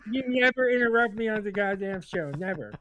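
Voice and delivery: loud, high-pitched outburst; loud mock-outraged comeback; indignant retort. Real, not acted. Sarcastic retort to the scolding.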